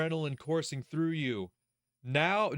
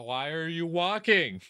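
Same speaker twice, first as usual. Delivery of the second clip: ordinary talk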